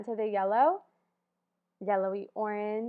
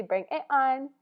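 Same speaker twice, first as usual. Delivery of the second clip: excited; 7 dB louder than usual. Shown as a demonstration.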